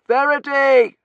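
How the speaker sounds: high, full-force call of a name; shout. A narrator's reading. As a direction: shout it across a burning church. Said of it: "I shouted".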